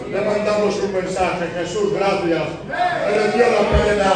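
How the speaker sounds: slow, loud, fervent proclamation; fervent preaching. Acted. Preaching at full force.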